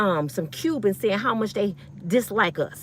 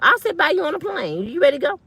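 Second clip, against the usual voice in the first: mock cheerful offer; 7 semitones higher than usual. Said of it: "I always tell people when they say that".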